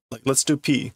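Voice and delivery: ordinary talk, nothing marked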